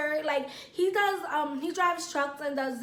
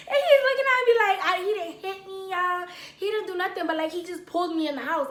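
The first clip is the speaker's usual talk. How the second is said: ordinary talk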